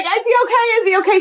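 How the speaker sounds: high anxious repeated question; worried neighbor. Retold or imitated. Neighbor at poolside asking about the boy, high and anxious. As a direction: fret like a startled stranger.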